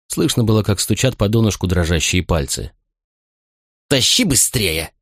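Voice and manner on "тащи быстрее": slow, barked soldier's order; gruff barked parade orders. A narrator's reading. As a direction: growl at him to hurry. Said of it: "рявкнул легионер".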